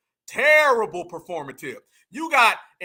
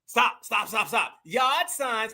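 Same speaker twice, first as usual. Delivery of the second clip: mock Massachusetts accent; much slower than usual. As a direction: mimic her Massachusetts "yard signs". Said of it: "that's Massachusetts all day".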